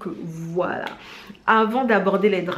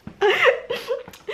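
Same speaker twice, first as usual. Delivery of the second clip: high-pitched giggle. Real, not acted. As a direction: let a high little giggle out. Laughing after her bit.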